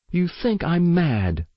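high-pitched realisation; shocked girl. A narrator's reading. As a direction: accuse them as it dawns, pitched high. Girl realises parents think her mad.

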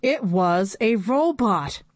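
ordinary delivery, nothing marked

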